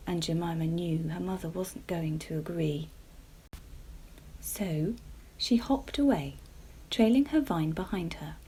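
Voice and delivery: ordinary delivery, nothing marked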